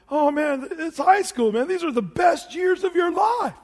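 high, loud mock pep talk to a teen; hammed-up grown-up shouting it's the best years; mock adult lecture. Preacher imitating a parent to a teen, loud mock enthusiasm.

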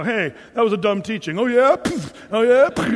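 loud, jeering parking lot taunts; hammed-up tough-guy challenge; mock quarrel. Two men squaring off in the lot, loud mock taunts.

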